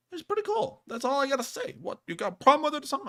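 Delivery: high, fast mock challenge; mock tough guy. Teasing the co-host with a mock tough-guy challenge. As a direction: pretend to pick a fight, hammed-up. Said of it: "I'm just kidding with you".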